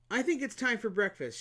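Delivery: a hint of a spoiled flower; demanding flower. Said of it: "she added an instant later".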